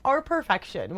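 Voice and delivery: ordinary talk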